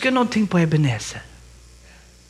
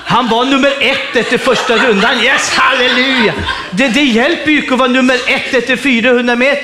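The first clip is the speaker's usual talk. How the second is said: raised, emphatic pulpit mockery of early triumph; animated preaching; 4 semitones higher, 4 dB louder than usual. Real, not acted. Preacher warning runners about starting too fast, animated and emphatic. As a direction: drive the marathon point home with energy.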